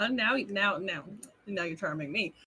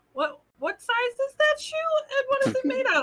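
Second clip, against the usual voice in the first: high voice; 14 semitones higher, 4 dB louder than usual. Acted. Player character asking, oddly high.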